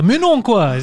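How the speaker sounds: teasing French-from-France impression; mock France French accent. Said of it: "qui parle comme ça".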